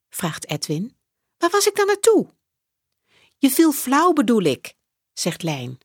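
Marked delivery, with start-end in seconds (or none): 1.4-4.7 put-on high child's voice. A narrator's reading. Edwin asks where he went, high child's voice. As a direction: ask it like a confused kid.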